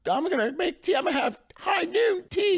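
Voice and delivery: high, excited self-quote; a hammed-up burst of tea excitement; giddy. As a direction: gush like a thrilled shopper. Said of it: "I was in there spending".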